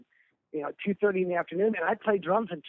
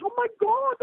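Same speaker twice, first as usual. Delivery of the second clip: much higher, awed Oh, my God; amazed dad; 4 dB louder than usual. Retold or imitated. Teary dad seeing the Journey check, amazed. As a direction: gasp it out like a stunned father. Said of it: "He was like".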